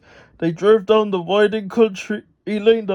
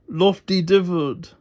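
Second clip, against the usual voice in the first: plain reading voice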